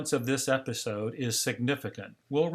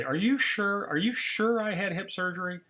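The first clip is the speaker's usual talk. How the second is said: higher, acted doubtful questioning; disbelieving; 7 semitones higher than usual. Replays his disbelieving repeated question.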